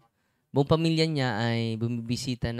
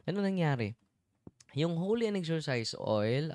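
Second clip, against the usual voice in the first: usual speaking voice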